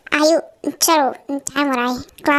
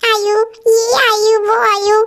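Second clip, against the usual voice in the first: mocking imitation of him; 4 semitones higher, 3 dB louder, slower than usual. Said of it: "हाँ".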